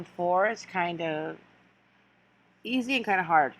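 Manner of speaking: ordinary delivery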